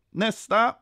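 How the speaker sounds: high, loud summons with a rising end; called out. A narrator's reading. Kontrollanten ropar till kön, called out. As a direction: call the next one up. Inspector calling the queue.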